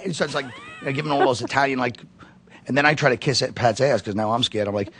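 usual speaking voice

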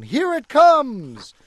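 calling out at the falling toy; excited call. Acted. Toy falls from the sky.

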